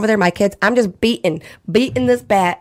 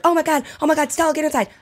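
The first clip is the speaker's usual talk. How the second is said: high, frantic call to the pig; alarm, calling the pig in; retold panic; 7 semitones higher, faster than usual. Calling her pig Stella away from the hissing possum, high and frantic. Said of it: "so I was like".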